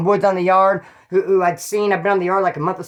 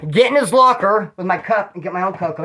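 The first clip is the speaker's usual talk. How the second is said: ordinary delivery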